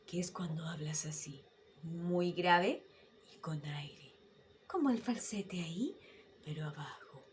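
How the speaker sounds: low falsetto. Shown as a demonstration. Teacher demonstrating low breathy falsetto. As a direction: breathe the words low and airy. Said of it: "falsete grave".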